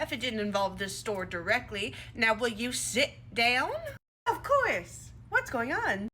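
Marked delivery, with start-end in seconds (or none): 4.3-6.1 a hint of a sugary Stepford wife, pitched higher. District manager greets the owner in a fake-sweet Stepford wife voice. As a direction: put on a lightly sweet, fake-perfect politeness. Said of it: "Sound like a Stepford wife".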